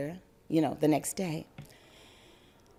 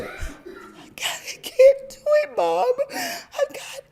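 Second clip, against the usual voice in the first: put-on high, slow whimpering little girl; badly faked sick child whine; fake whimper; 4 dB louder, much slower than usual. Girl faking asthma to her mother, high whiny plea.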